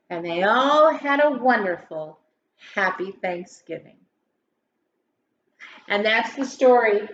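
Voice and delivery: lilting rhymed storybook read-aloud; story narration. Acted. Teacher reading a Thanksgiving poem to grade schoolers, lilting. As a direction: read it like a bedtime poem for kids.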